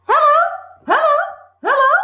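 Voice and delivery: high, loud parrot hello; parrot calling. Acted. Parrot in the chimney calling down to Matilda, high and loud. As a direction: squawk it down the chimney. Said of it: "came the voice again".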